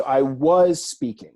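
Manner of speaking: "was" punched, then "speaking" falls away; stress on WAS. Shown as a demonstration. Teacher translating the imperfect, "was" stressed. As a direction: lean loudly on "was".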